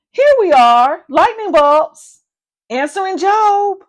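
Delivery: loud, bright announcement of arrival; lightning bolts answering for duty; lightning bolts reporting. Acted. Lightning bolts reporting to Job, loud and proud.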